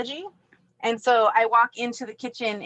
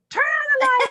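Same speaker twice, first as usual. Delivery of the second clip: much higher, loud command; yelling at speaker; 5 dB louder than usual. Retold or imitated. Yelling at the smart speaker, loud. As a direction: bark it at the device. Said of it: "and I'm like".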